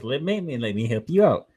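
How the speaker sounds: normal talking voice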